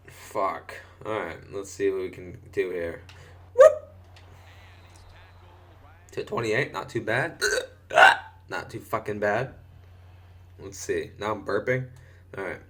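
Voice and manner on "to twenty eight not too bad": ordinary delivery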